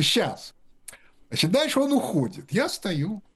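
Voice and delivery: normal talking voice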